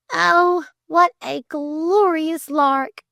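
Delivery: high, slow and trembling with joy; a girl thrilled to bits, voice quivering; quivering delight. Acted. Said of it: "quivered Flame".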